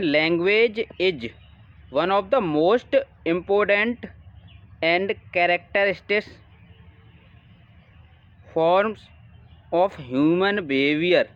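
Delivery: slow, deliberate reading of an English exam question; dictation-paced reading aloud. Acted. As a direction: read it out word by word for the class. Reads English question aloud slowly.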